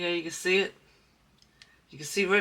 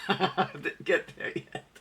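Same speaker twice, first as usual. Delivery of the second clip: laughing; 5 semitones higher, slower than usual. Real, not acted. Laughing at own slow progress.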